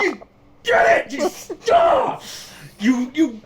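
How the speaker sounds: crushing hug, yelling in delight through laughter; ecstatic shout. Acted. [name] cheering the bird as he hugs, overjoyed shout.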